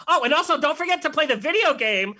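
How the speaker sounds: ordinary delivery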